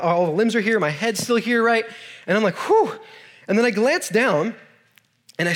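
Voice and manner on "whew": high sighing whew of relief; mock relieved whew. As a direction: breathe out the relief, pitched way up. Relieved after surviving the crash.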